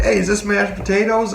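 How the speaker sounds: high, insistent call to get her attention; calling again to a friend who isn't listening. Retold or imitated. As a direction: raise the pitch and call across the table.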